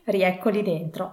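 ordinary delivery

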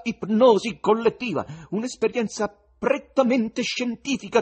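loud, high showman's announcing voice; sonorous presenter. A narrator's reading. Bengalski addressing the audience, loud ringing announcer. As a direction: announce it to the hall with forced confidence. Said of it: "con la voce più sonora".

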